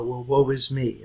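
softly imitated self-pity; understated pity-party imitation; mock sulk. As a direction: mumble it like a sulking child, half-hearted. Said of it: "and they, they sulk".

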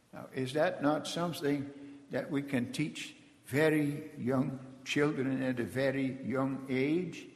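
ordinary talk

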